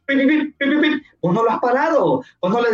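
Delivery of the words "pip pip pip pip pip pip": high mock car horn "pip, pip"; imitated taxi horn. Taxi driver honking at a passerby on the street, high mock horn. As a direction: honk it like a taxi horn, much higher. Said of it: "te empieza a gritar".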